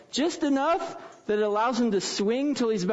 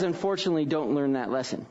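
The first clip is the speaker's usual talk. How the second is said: ordinary talk, nothing marked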